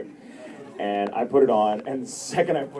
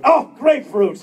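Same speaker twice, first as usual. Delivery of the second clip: high, loud dramatic exclamation; dramatic grapefruit; 14 semitones higher, 7 dB louder than usual. Retold or imitated. Mimicking an English speaker, shouting grapefruit high and grand. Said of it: "hearing someone in English going".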